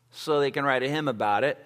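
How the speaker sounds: ordinary talk, nothing marked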